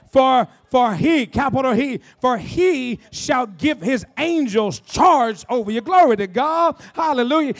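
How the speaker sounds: slow, loud, word-by-word verse; weighty reading bursting into praise; emphatic scripture preaching. Real, not acted. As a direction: proclaim the verse with drawn-out key words.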